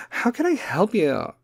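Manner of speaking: mock the gentle line in a high celebrity voice; Kim K. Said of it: "is going to be all Kim K".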